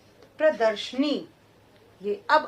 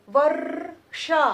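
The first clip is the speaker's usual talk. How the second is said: Varsha stretched very slowly and louder; slow pronunciation; 3 semitones higher, 4 dB louder, much slower than usual. Shown as a demonstration. Teacher slowly pronouncing Varsha for the class. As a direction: say Varsha syllable by syllable. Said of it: "syllable demo".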